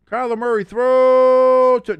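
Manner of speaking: drawn-out, loud, high play call; excited call. Real, not acted. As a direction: stretch it as the ball flies. Play-by-play, pass in air.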